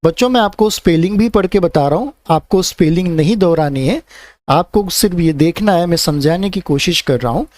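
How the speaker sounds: ordinary delivery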